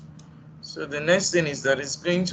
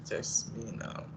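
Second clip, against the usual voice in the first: whispered mutter; 5 semitones lower, 11 dB quieter, slower than usual. Real, not acted. Muttering while typing a request.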